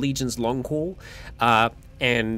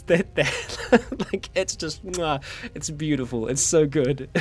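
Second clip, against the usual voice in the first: laughing admiration; 2 semitones higher, slower than usual. Real, not acted. Laughs praising friend's taxi driver voice.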